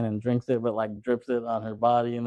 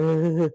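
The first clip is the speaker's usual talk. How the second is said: higher weird mock giggle; imitated weird laugh; 5 semitones higher, 3 dB louder than usual. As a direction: imitate someone laughing oddly. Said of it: "he's, like, laughing weirdly".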